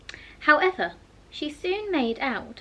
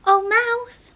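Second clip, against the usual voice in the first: higher, louder repeat of the call; calling again; 12 semitones higher, 8 dB louder than usual. A narrator's reading. Alice repeats her grand hail to the silent mouse. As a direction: call the mouse once more, more insistently.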